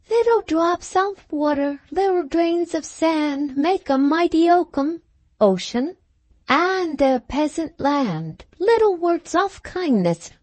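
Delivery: put-on high, slow little-child recital with the stress on the wrong words; small child reciting a poem, sing-song and slow; baby voice. A narrator's reading. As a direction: recite it like a tiny boy proud of his verse. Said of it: "Then in his baby voice, and putting the emphasis on the wrong words".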